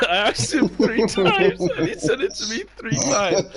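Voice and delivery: can't stop laughing while telling it. Real, not acted.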